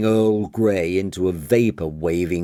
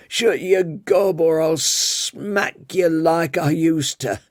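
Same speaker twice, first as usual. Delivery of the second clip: put-on wag a finger like a cranky old tea lady; scolding old woman; 7 semitones higher than usual. Old tea lady scolding Daniel for whinging, high old voice.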